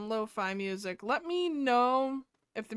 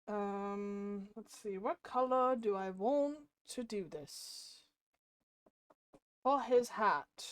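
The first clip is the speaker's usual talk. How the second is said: put-on, exaggerated British accent; 6 dB louder than usual. Streamer made to talk British to the chat, mock British accent. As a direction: play at being British, badly. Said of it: "you're British now".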